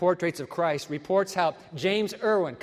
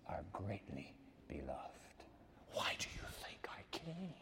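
soft whispered reassurance, much softer; 11 semitones lower, 17 dB quieter than usual. Shown as a demonstration. Preacher whispering to the congregation that they are loved. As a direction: lean in and whisper it to each listener.

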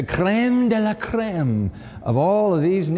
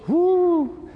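whoop; 12 semitones higher than usual. Real, not acted. Exclaiming mid-sermon.

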